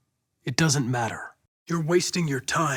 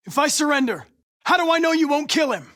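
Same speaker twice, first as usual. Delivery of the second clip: higher, louder bargaining; tense bargaining; 8 semitones higher, 4 dB louder than usual. Acted. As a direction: press the question in a standoff. Standoff bargaining.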